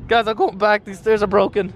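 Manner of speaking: ordinary talk, nothing marked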